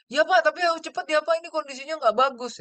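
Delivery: high, fast, anxious pressing; urging hurry. Retold or imitated. Doctor pressing patient to go quickly, fast and high.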